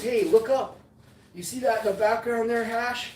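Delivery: put-on a light Michigan accent for Squish; Squish's accent. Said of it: "This is Squish's kind of accent".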